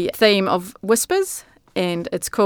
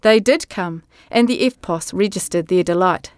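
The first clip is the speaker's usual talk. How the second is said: ordinary talk, nothing marked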